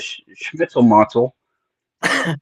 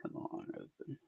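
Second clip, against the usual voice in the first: whisper; 28 dB quieter than usual. Real, not acted. Struggling with a lock.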